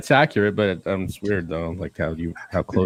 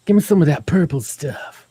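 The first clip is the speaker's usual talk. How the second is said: high, crooning mock lover; smooth Chris; 7 semitones higher, 5 dB louder than usual. Chris sweet-talking for powdered sugar, crooning. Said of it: "voicing Chris".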